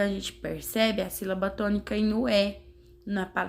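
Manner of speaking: ordinary delivery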